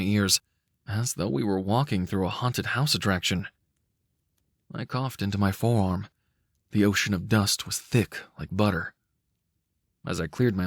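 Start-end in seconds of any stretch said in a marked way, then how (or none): none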